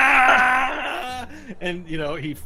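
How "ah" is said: high, loud drawn-out "ah"; acted cry of agony. Game master plays the mocked orc, loud pained cry. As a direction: scream as if struck by the insult. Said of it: "the insult enters his mind. He's like".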